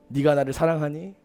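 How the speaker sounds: a softly spoken question of love; tender question. Acted. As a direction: ask it like a gentle friend restoring trust. Jesus gently asks Peter.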